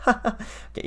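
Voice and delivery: breathy self-conscious laugh into okay; awkward laugh. Real, not acted. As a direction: laugh nervously at your own joke. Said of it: "I'll laugh, uh, awkwardly at my own jokes".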